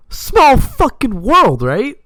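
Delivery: very high, loud and slow, stretched out; amazed. Retold or imitated. Telling the buddy about the coincidence, high loud and drawn out. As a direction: draw every word out in disbelief.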